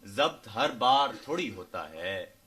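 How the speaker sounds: measured ghazal recitation, phrase by phrase; poetry recital. Acted. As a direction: recite couplets to an audience, unhurried and declaimed.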